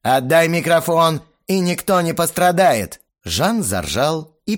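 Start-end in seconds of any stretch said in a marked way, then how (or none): 0.0-3.0 high, loud mock-menacing yell; threatening shout. A narrator's reading. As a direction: announce a coup with menace. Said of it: "угрожающим тоном прокричал я".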